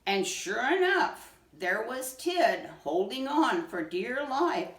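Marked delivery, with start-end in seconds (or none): none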